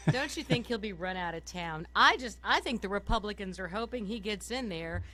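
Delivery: ordinary delivery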